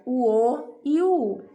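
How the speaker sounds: normal talking voice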